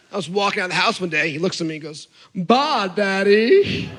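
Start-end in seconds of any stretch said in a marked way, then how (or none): 2.3-3.9 put-on toddler with a Southern accent, swooping up at the end. Toddler greeting Dad on his way out, Southern accent. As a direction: imitate a little boy's Southern twang. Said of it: "he had a Southern accent".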